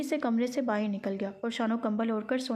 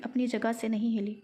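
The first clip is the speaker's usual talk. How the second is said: ordinary delivery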